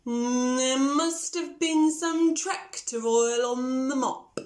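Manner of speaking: slow cow explaining. Acted.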